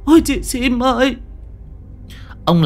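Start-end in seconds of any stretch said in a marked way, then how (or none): 0.1-1.2 high, pleading moan in her sleep; wife pleads with a ghost in her sleep, high moaning; moaning in a nightmare. Acted. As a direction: beg the ghost from inside a dream. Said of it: "tiếng của bà Lụa ú ớ".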